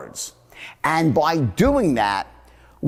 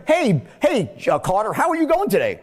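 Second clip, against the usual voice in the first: higher, eager hello to a friend; over-keen greeting; 4 semitones higher, faster than usual. Shown as a demonstration. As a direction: greet a friend with exaggerated interest. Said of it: "would you say to your friend".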